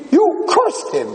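cursed pitched high and leaned on; the rabbi springing his proof on the girl; emphatic reveal. Retold or imitated. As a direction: land "cursed" up high as the punchline. Rabbi hits the key point.

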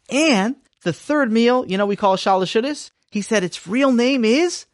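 put-on young Sammy bargaining with his father; scheming child. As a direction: sell the made-up rule brightly. Sammy argues for sodas.

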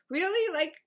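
high, loud disbelieving "Really?"; incredulous. Retold or imitated. Driver mocking her own apology after the crash, incredulous. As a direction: scoff at yourself in disbelief. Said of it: "and I'm like".

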